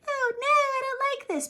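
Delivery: tiny high squeaky voice, mocking a timid reaction; meek mock voice. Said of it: "Not quiet".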